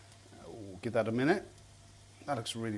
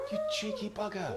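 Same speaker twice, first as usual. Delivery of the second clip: playful scolding. Real, not acted. Scolding the cat on the table.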